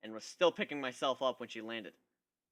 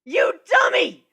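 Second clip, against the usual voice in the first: high, slow, loud screech; furious shrieking griffin; 19 dB louder, slower than usual. Acted. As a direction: scream it as you dive after him. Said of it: "Gilda screeched".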